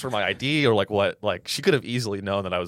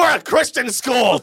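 loud, slow, high yell; mock shout; 13 semitones higher, 6 dB louder, slower than usual. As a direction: bark it like a slammed door. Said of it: "I would've been like".

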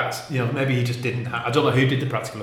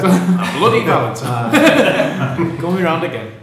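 Yorkshire accent; 7 semitones higher, 12 dB louder, much slower than usual. Shown as a demonstration. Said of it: "I like it in a Yorkshire accent".

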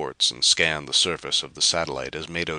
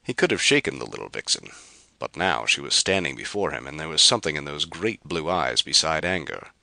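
ordinary delivery

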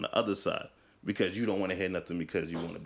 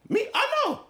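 squeaky protest; 5 dB louder than usual. Real, not acted. Defending self in debate.

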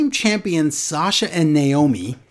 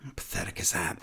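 whisper; 8 semitones lower, 15 dB quieter, faster than usual. Real, not acted. Aside, unvoiced whisper.

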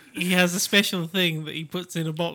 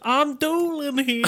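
slow mock New York tough guy; New York accent; 6 semitones higher, 6 dB quieter, slower than usual. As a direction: drawl it like a Brooklyn wiseguy. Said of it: "he tried to do a New York accent".

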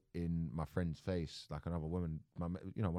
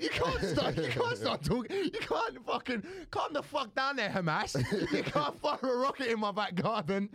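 high, loud, half-laughing rant; mock heated yell; 3 dB louder than usual. Retelling what he yelled at the attacker, high and laughing. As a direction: rant at him with a laugh breaking through.

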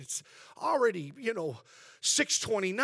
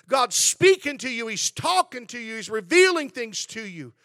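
louder, higher preaching build; 4 semitones higher, 8 dB louder than usual. Acted. Pastor rousing the church to feed their faith, preaching loud. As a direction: preach it out to the congregation.

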